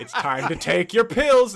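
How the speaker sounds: mock nurse announcement. Voicing psych ward announcement.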